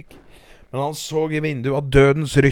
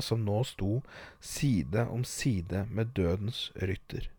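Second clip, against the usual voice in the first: usual speaking voice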